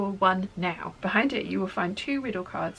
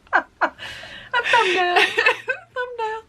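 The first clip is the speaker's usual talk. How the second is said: high, helpless laughter through words; giggling fit; laughing; 14 semitones higher, 5 dB louder, much slower than usual. Real, not acted. Laughing fit over thumbnail.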